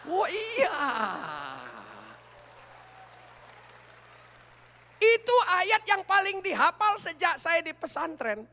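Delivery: high and loud teasing; mocking excitement. Real, not acted. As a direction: tease the men about the verse.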